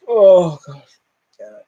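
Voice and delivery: usual speaking voice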